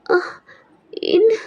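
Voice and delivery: high startled "என்ன?" with a rise; startled waking. Acted. Girl waking in pain, startled and confused. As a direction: gasp awake, confused. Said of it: "அப்படின்னு லைட்டா எந்திரிக்கிறீங்க".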